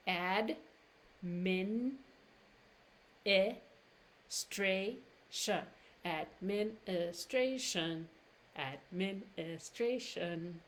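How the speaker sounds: administration slow with a long stray. Shown as a demonstration. Teacher drilling administration slowly. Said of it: "that stray becomes long".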